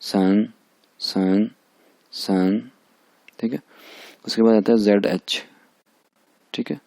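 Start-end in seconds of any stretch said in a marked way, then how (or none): 0.0-2.6 sun, said slowly and clearly; teacher drilling sun; Chinese syllable demo. Shown as a demonstration. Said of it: "teacher models syllable".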